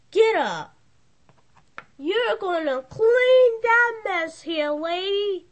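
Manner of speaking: high, loud, slow command; stern order. Acted. Owner ordering the lady to clean up the mess, stern. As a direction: lay down the order firmly.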